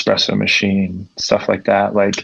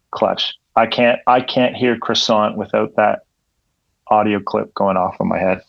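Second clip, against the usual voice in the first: ordinary talk, nothing marked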